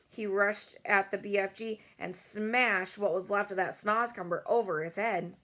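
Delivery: plain reading voice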